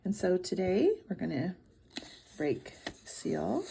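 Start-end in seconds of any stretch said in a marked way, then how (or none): none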